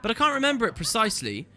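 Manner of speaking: high, deferential servant voice; fussy old servant. Acted.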